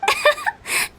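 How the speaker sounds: high, playful cackling giggle; mischievous giggle. Acted. Director laughing after creeping Paimon out, mischievous giggle. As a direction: giggle at your own spooky joke.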